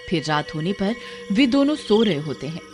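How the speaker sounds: usual speaking voice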